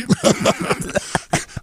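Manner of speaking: airy high wheeze of a laugh; high breathless laugh. Real, not acted. Laughing at his planned celebration dinner, airy wheeze.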